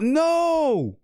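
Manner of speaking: high, drawn-out cry of NO; screamed no. Retold or imitated. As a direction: scream it out in long dismay. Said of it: "and I scream out".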